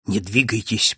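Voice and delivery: ordinary delivery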